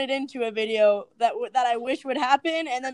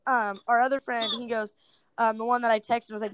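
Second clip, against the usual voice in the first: ordinary talk, nothing marked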